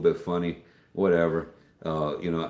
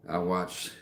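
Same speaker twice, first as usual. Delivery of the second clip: usual speaking voice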